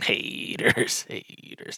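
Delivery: whispered sing-song chant; whispering. Acted. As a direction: whisper it under your breath.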